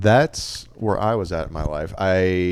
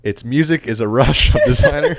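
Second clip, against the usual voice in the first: louder, higher go at a tongue twister; tongue-twister attempt; 6 semitones higher, 8 dB louder than usual. Shown as a demonstration. Host takes co-host's dare to say it fast, tongue-twister attempt. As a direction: rattle it off louder and higher, as if challenged. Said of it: "Say that five times fast".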